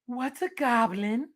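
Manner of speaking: slow, worried question in a put-on voice; scared mom. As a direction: ask it with wide crazy eyes. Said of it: "goes to Dad and be like".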